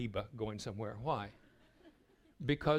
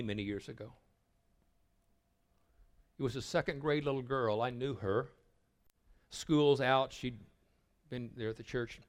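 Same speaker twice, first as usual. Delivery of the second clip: ordinary talk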